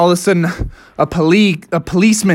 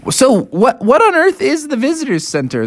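higher-pitched, puzzled asking; baffled question; 6 semitones higher than usual. Acted. Family asks about the visitors center, baffled. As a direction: ask it in exaggerated bewilderment.

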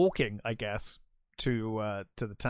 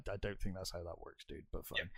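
muttered aside; 8 semitones lower, 14 dB quieter, faster than usual. Retold or imitated. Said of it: "And it's like".

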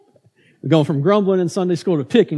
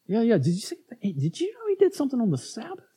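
gossipy whisper; 5 semitones higher, 5 dB quieter, faster than usual. Retold or imitated. Gossiping crowd voice.

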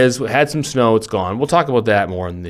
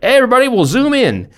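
high, loud, chirpy group invite; pitched way up, mock-cheery announcement; mock enthusiasm; 13 semitones higher, 5 dB louder, faster than usual. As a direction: imitate an over-keen call to everybody. Mimicking everyone's early Zoom enthusiasm.

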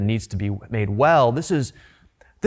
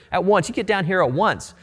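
a mock harsh order, pitched higher; harsher command; 6 semitones higher than usual. Commander ordering Elijah off the hill, mock harsh. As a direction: bark it like an impatient commander. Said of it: "He gets even harsher".

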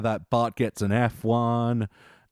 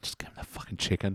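whispered aside; 5 semitones lower, 10 dB quieter, faster than usual. Retold or imitated. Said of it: "And they're like".

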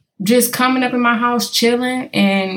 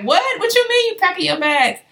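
higher, lightly voiced shocked friend; surprised friend; 11 semitones higher than usual. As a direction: friend can't believe it.

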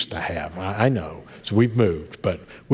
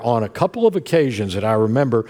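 usual speaking voice

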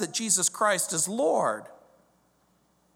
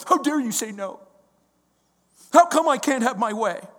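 hammed-up spoiled child, higher; mock tantrum; 5 semitones higher, 5 dB louder than usual. A spoiled child refusing God's no, mock tantrum. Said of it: "kicking and screaming".

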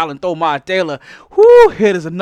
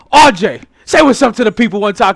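ordinary talk, nothing marked

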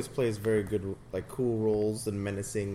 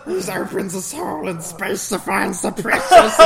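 high, loud hissing Gollum; 9 semitones higher, 11 dB louder than usual. Retold or imitated. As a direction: laugh through a Gollum croak. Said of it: "It sounded like Gollum to me".

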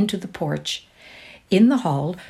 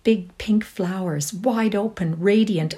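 plain reading voice

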